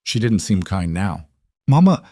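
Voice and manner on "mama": shaky voice. A narrator's reading. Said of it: "Margaret's voice was shaking".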